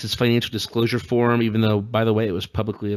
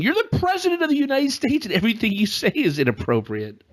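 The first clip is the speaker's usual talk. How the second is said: incredulous; 12 semitones higher than usual. Real, not acted. Incredulous retort to press line.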